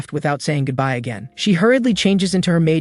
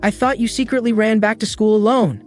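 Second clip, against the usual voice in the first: high, upset and pleading; distressed; 8 semitones higher than usual. Acted. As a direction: pour out the fright in relief. Maid rushes into his arms, frightened.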